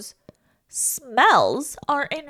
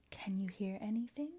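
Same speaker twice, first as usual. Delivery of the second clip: whispered echo of the line; faint echo; 3 semitones lower, 15 dB quieter, faster than usual. Acted. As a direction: repeat it as a soft distant echo. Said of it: "we have an echo in here".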